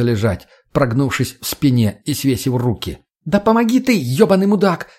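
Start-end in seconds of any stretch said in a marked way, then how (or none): 3.2-4.9 high, exasperated squeal; acted angry screech; shrieked. A narrator's reading. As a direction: shriek for help at a useless companion. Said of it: "взвизгнула Тоня".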